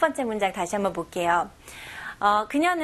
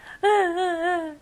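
demo wail; 8 semitones higher than usual. Shown as a demonstration. Demo of crying after sob lesson.

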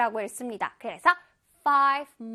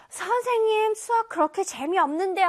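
lightly voiced student complaint, higher at first; 투덜대는 학생; 4 semitones higher, faster than usual. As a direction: play a student objecting to the teacher. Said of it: "어떤 친구들은". (선생님께 투덜대는 학생 말투)

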